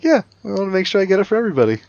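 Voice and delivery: put-on high, rushed kid voice; imitating young son. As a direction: explain it fast like an earnest child. Son innocently justifying himself to dad.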